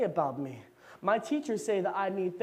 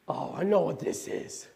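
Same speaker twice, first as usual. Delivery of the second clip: ordinary talk, nothing marked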